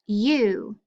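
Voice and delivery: "U" said alone as a long oo; isolated vowel sound. Shown as a demonstration. Teacher showing kids the ue sound. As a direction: give just the "U" sound. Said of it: "Digraph U E".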